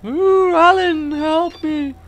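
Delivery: high, wailing mock damsel in distress; hammed-up falsetto cry for help; Alice. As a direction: wail it slowly, loud and very high. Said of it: "Alice like a carrot in front of his face".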